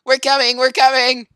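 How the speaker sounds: high, loud eager yell; shouting we're coming; excited shout. Real, not acted. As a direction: call out as if rushing in.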